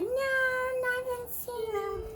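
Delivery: high, soft little toy answer; toy's voice. Pretend. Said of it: "She said".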